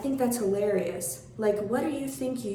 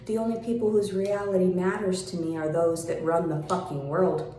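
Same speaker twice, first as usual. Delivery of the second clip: ordinary talk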